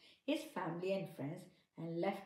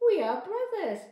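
much higher, louder mouse voice; squeaky mouse; 12 semitones higher, 11 dB louder than usual. Acted. Mouse assuring the bride's father, high and loud. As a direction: insist like a tiny mouse. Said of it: "said the mouse".

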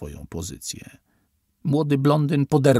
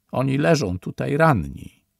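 ordinary delivery, nothing marked

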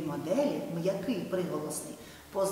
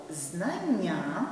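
знання said slowly, the doubled нн held; slow example. Shown as a demonstration. Teacher slows down to show the example word. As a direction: draw out знання for the class. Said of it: "teacher models the example word".

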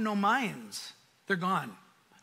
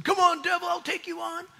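higher, louder cocky shout; hammed-up challenge to the devil; mock boast; 9 semitones higher, 4 dB louder than usual. Pastor mocks believers who strut at Satan, cocky shout.

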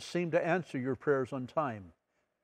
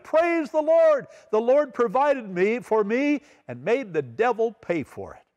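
triumphant shout; 6 semitones higher than usual. Retold or imitated. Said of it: "threw up her arms again and shouted".

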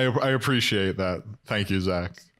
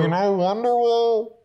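mock audience request; 10 semitones higher, 4 dB louder than usual.

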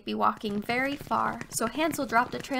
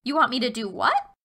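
put-on puzzled girl; 4 dB louder than usual. A narrator's reading. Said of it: "she said".

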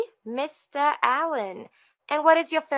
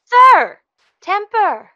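much louder, higher scolding; sharp reprimand; 7 semitones higher, 12 dB louder, much slower than usual. Acted. Receptionist to caller who lost his temper, sharp reprimand. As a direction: cut the caller down loudly.

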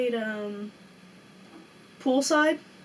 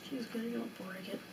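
whispered aside; 2 semitones lower, 18 dB quieter than usual. Real, not acted. Aside about her friend.

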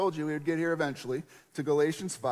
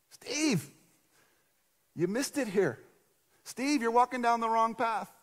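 voice of the Spirit; 7 semitones higher than usual. Retold or imitated. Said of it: "It's like every day".

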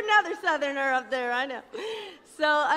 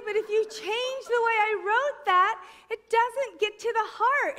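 pleading her point; 7 semitones higher than usual. Retold or imitated. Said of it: "And I used to say".

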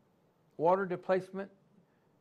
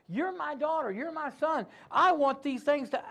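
ordinary talk, nothing marked

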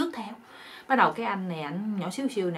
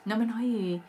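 usual speaking voice

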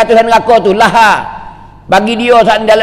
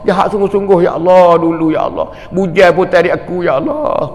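tearful prayer; 5 semitones lower, 3 dB quieter than usual. Retold or imitated. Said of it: "Akik tangi".

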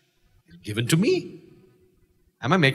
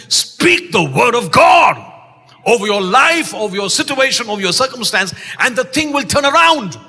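preaching at full force; 4 semitones higher, 5 dB louder than usual. Real, not acted. Preacher urging the church to speak the word, loud.

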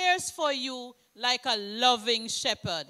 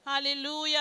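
ordinary talk, nothing marked

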